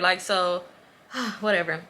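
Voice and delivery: ordinary talk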